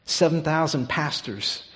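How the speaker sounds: normal talking voice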